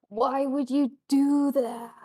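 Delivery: loud, drawn-out groaning spirit; dying creature's long, loud groan. Acted. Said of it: "it's groaning".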